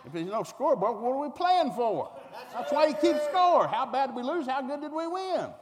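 high, loud, near-shouted rant; a loud rant for keeping score; indignant rant. Real, not acted. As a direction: rail at no-score baseball.

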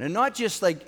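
ordinary talk, nothing marked

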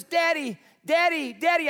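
lightly imitated little girl pestering dad; child calling. Small child calling her father.

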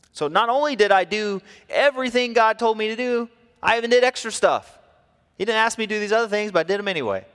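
Saul boasting. Acted. Saul insisting to God he did extra. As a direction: puff up like a proud king. Saul's defence voiced by preacher.

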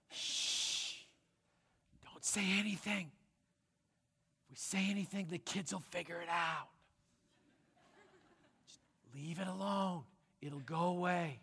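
urgent shushing whisper; very soft, secretive stage whisper; hushed secrecy. Acted. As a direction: hush it up so the kids won't hear. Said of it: "This was the message".

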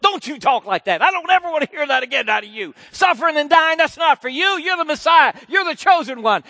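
high, loud scolding rebuke; scolding Peter. Acted. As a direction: tell him off hard. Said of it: "begins to beat him up verbally".

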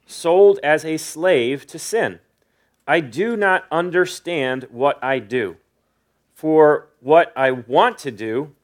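slow, measured reading with pauses between clauses. Real, not acted. Preacher reading Paul's tongue twister to the congregation, slow and deliberate. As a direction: read it slowly so each phrase lands. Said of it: "read it pretty slow".

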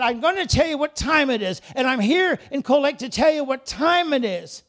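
leader's firm call to the crowd; rallying declaration. Acted. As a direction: tell them straight what time it is. Nehemiah rallies the people.

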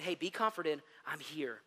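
softly voiced Jesus telling his followers he is near, tender; tender comfort. A narrator's reading. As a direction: soothe them like a frightened child.

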